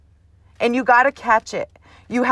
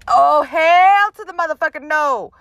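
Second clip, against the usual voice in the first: high-pitched, louder protective outburst; mama-tiger outrage; 7 semitones higher, 4 dB louder than usual. Acted. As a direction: defend your cub, claws out. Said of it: "Mommy Tiger would come out, claws blazing".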